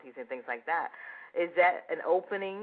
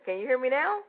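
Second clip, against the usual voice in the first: raised, higher and louder; checking they can hear; 9 semitones higher, 5 dB louder than usual. Real, not acted. Host checks the guest can hear now, raised and louder. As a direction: project it up to a listener with bad sound.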